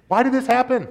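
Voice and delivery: high, pleading cry to God; pleading Job. Acted. Job crying out to God, pleading and high. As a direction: ask it like a man in anguish.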